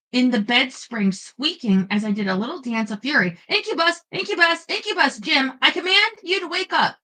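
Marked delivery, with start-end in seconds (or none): 3.5-7.0 higher, urgent commanding yell; screaming at Jim. Acted. Woman screams at Jim to wake and deal with the incubus. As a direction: shriek for the sleeping helper to wake. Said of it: "screamed at Jim".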